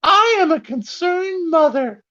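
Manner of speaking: high falsetto Karen mom; whiny entitled mom in falsetto; Karen mother voice. Retold or imitated. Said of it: "Karen mother writes to Elon Musk and she's like".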